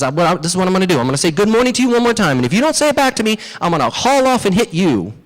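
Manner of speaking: high, fast, hot-headed warning; Briar Rabbit threatening. Acted. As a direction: scold in a worked-up, high voice. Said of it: "who has a little hot-headed".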